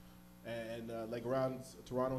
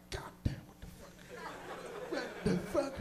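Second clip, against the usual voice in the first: stoned whisper then yelp; 11 semitones higher, 3 dB quieter than usual. Retold or imitated. Stunned by the high.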